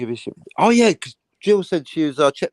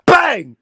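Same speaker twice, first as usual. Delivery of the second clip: gunshot shouted high and loud; playful shouted gunshot noise; shouted bang; 12 semitones higher, 9 dB louder than usual. Pretend. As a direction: yell the shot out like a toy gun. Mimics gunshot in story.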